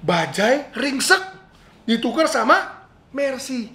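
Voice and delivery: a gasp, then slow, high disbelief; gasping amazement. Acted. Pastor marvels that a wrecked bajaj becomes a Mercedes, amazed. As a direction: marvel at the impossible swap.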